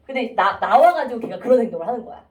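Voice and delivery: ordinary delivery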